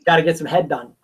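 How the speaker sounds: deadpan, holding back a laugh; straight face. Shown as a demonstration. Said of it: "Say that with a straight face".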